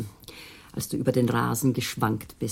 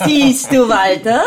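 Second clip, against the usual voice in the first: loud call to the next room, higher; 9 semitones higher, 11 dB louder, slower than usual. Acted. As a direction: call him over, bright and loud. Wife calls husband across room. (ruft laut nach Walter)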